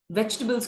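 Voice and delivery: वेजिटेबल्स in three syllables, "VECH-tuh-bulz"; correct pronunciation. Shown as a demonstration. Teacher models the word for learners, correct short pronunciation. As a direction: say वेजिटेबल्स short, swallowing the middle vowel. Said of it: "it's vegetables".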